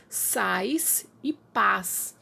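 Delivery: very slowly, each word apart; slow demo. Shown as a demonstration. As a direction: draw out "Sais" and "paz" to compare the sounds. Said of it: "sons parecidos". ("Sais e paz" bem devagar, palavra por palavra)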